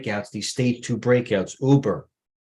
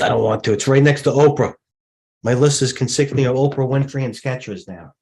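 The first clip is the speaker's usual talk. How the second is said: mock protest; 4 semitones higher, 6 dB louder than usual. Real, not acted. Mock protest about his list.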